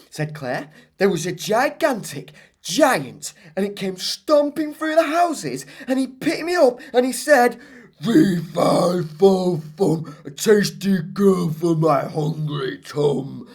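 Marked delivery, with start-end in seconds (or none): 1.0-7.6 put-on high, excited child telling a wild story; Claire's tall tale. 8.0-13.5 booming slow giant chant; giant's voice. Acted.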